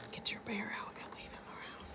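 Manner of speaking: whispered aside to the class. Real, not acted. Teacher whispers instruction to class.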